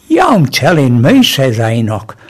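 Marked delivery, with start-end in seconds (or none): 0.1-1.2 emphatic retort. Acted. Carpenter retorts in joke.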